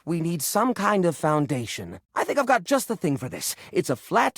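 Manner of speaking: usual speaking voice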